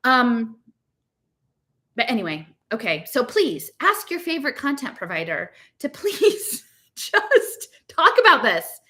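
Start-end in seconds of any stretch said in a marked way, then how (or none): none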